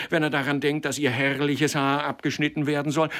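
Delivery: high, loud, rushed accusation; heated scolding without letup; vehement tirade. A narrator's reading. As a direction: pelt the culprit with reproaches. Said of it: "rief Türkisch Gelb heftig".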